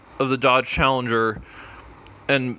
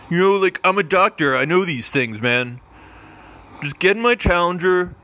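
higher, excited doctor role; doctor character voice; 5 semitones higher than usual. Acted. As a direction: pitch it up, pushy and eager. Doctor character talking scorpion round.

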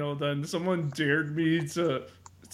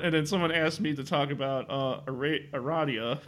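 normal talking voice

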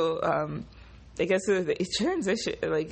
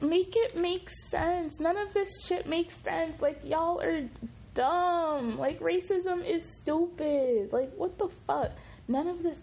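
exasperated plea; 11 semitones higher than usual. Real, not acted. Vlogger ranting that racism is stupid, exasperated. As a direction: plead in worn-out high pitch. Exasperated rant, nothing makes sense.

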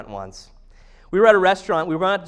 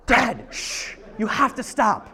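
gasp, then a higher scandalized hush; urgent shushing; 4 semitones higher, slower than usual. Retold or imitated. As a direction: imitate embarrassed kids frantically hushing their dad. Family hushing dad in restaurant.

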